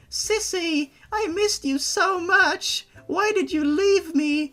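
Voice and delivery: put-on high pleading little-girl voice; a small child begging her sister not to leave; high childish voice, pleading; Benna voice. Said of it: "This is my Benna voice from now on".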